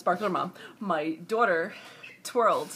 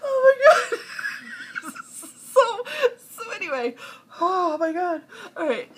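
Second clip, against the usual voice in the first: high, loud, laughing too hard to talk; real helpless laughter; 12 semitones higher, 6 dB louder than usual. Real, not acted. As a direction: struggle to recover from a laughing fit. Helpless laughter, trying to resume.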